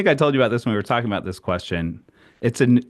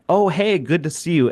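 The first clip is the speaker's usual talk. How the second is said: higher-pitched, quick hello in passing; friendly passing greeting; 6 semitones higher than usual. Retold or imitated. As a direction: imitate a polite neighbor's brief greeting. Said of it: "a very good job of just being like".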